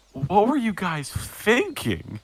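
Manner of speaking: high, drawn-out disbelief; incredulous scolding. Acted. Lad turns on the party after the captain leaves, incredulous scolding.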